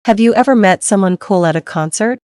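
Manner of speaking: ordinary talk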